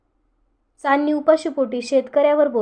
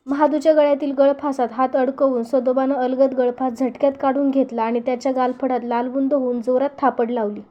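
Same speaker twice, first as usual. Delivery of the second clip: plain reading voice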